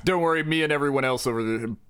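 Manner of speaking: ordinary talk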